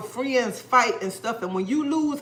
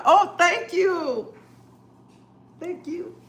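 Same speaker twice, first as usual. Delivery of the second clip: touched thanks; 6 semitones higher, much slower than usual. Real, not acted. Viewer compliments her skin.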